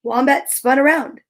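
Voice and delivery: ordinary delivery, nothing marked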